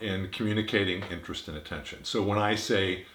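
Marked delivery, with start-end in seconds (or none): none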